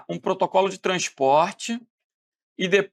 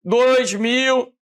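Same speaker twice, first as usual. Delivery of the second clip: garbled syllables; 4 semitones higher, 4 dB louder, much slower than usual. Shown as a demonstration. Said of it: "de repente vem".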